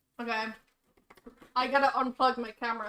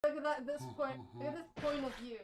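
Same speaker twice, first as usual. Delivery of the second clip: ordinary talk, nothing marked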